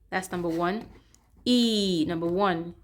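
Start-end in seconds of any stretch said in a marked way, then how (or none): 1.4-2.0 "E" held as a long, steady vowel; long E sound. Shown as a demonstration. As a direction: stretch "E" out as a long ee. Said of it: "it is a long sound".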